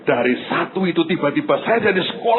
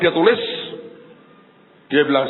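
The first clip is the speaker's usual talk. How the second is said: ordinary delivery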